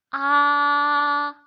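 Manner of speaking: loud "아" drawn out on an unchanging high pitch; first tone. Shown as a demonstration. Teacher repeating the first-tone drill, long high steady "아". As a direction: hold "아" level and high as long as possible. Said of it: "최대한 길게 소리 내시면 됩니다".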